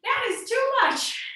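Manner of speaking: high, loud whoop of too much; mock-overwhelmed outburst, loud and high; overwhelmed exclamation. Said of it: "I was like".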